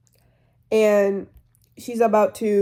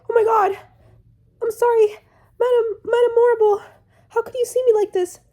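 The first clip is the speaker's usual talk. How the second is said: put-on much higher, girly and flustered apology; squeaky flustered Glinda; 13 semitones higher than usual. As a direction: gush an apology in a tiny high girly voice. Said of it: "Glinda's like".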